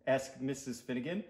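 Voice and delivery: ordinary delivery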